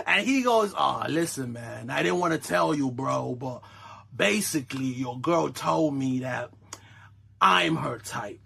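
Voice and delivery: low, soft mock smooth talker; smug pretty boy. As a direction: play it cool and condescending. Good-looking dude voiced smug.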